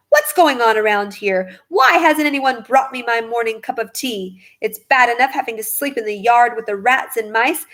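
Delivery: plain reading voice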